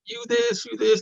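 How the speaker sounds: high-pitched pretend tirade, no real shouting; half-hearted imitation of blowing up; mock angry outburst. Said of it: "get angry, start shouting at her".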